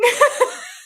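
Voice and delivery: a very high, loud laugh; real delighted laugh. Real, not acted. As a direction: burst out laughing. Laughing at the quote.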